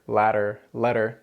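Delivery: ladder, letter with a flapped middle consonant; single tongue tap. Shown as a demonstration. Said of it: "take that sound from better".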